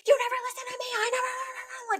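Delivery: very high, wailing mock teen; shrill hammed-up teenage wail; teen meltdown. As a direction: play a teen melting down in tears. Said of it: "like, tears, crying".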